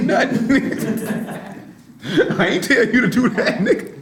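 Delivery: quoting himself through laughter; laughing. Retold or imitated. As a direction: let the laugh break through the words. Laughing at retold exchange.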